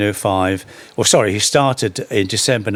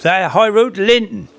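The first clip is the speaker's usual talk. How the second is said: Linton clipped to a Norfolk Lynn, said high; broad Norfolk yokel saying That High Road to Linton; Norfolk accent; 9 semitones higher, 3 dB louder than usual. Retold or imitated. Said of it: "coming from Baconsthorpe".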